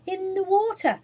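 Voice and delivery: high, bright, cheerful reply; the cook pleased with her cool bath; high cheerful answer; happily. A narrator's reading. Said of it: "replied the cook happily".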